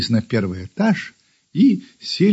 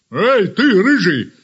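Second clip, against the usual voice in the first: a dog calling out, a little higher; 4 semitones higher, 3 dB louder, slower than usual. A narrator's reading. Big dog to the kitten in the yard, calling out. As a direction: hail someone across the yard. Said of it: "позвала собака".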